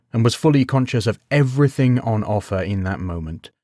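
ordinary delivery, nothing marked

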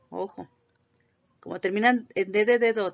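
normal talking voice

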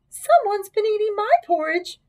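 high-pitched mother bear; middle-sized Mama Bear voice. A narrator's reading. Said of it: "said in her middle-sized voice".

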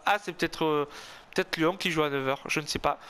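ordinary talk, nothing marked

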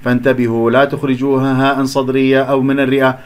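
ordinary talk, nothing marked

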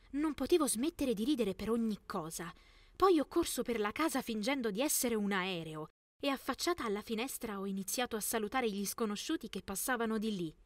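usual speaking voice

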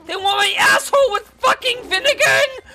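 high-pitched panicked yelling; frantic screaming rant. Real, not acted. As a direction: screech it, climbing to a scream at the end. Frantic rant as panthers attack.

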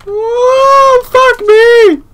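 very high loud cry of shock; startled shout. Real, not acted. Gamer hit by a jump scare, high shocked yell. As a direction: yell out in sudden fright.

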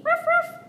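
high, loud playful yapping; puppy bark. Pretend. Storyteller barking as the little puppy, high yaps. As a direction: yap like a tiny puppy.

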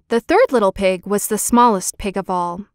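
usual speaking voice

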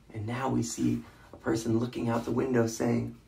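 ordinary delivery, nothing marked

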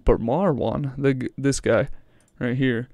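ordinary talk, nothing marked

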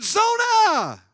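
the father bursting into the bedroom; shouting Zona. Retold or imitated. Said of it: "kicked the door open".

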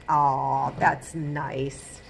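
plain reading voice

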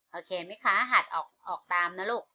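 usual speaking voice

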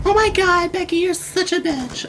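put-on very high valley girl voice; man doing a high girly voice. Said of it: "let's see if I can do my girl voice".

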